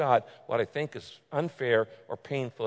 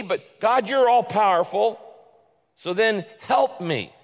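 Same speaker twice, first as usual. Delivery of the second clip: rising, louder plea praising God's goodness; fervent prayer; 6 semitones higher, 4 dB louder than usual. Shown as a demonstration. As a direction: build up to a passionate appeal, slowing at the end. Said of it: "complaining to God and at the same time saying".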